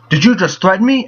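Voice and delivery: hammed-up Deadshot impression; imitating Deadshot. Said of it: "he goes".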